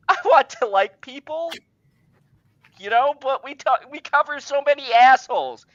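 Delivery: laughing. Real, not acted. Laughing at his own joke.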